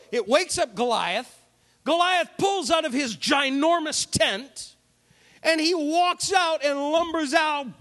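normal talking voice

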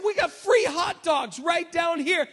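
calling out loud and high across a street; shouted across the street. Retold or imitated. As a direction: hail some guys on the far side of the road. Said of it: "And so I shouted across the street".

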